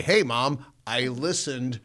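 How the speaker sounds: loud, high, slow gloating; mock triumph. Son planning his boast to mom about the tapes, smugly triumphant. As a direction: announce it proudly, stretching out the boast.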